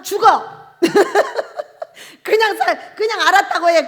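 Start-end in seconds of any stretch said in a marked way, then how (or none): 0.0-0.7 stern command. Acted. Preacher commanding the congregation. 0.8-2.2 high, loud laughing burst; high cackling laugh; burst of laughter. Real, not acted. Laughs after his command.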